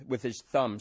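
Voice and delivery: usual speaking voice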